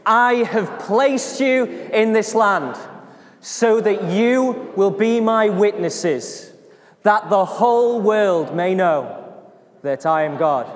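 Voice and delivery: slow, loud congregational proclamation; proclaiming. Shown as a demonstration. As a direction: declare it phrase by phrase for a crowd to join. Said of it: "I want us to proclaim this together".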